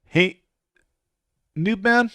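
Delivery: loud, high call to a friend. Real, not acted. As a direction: shout his name across the room. Calling out to a friend.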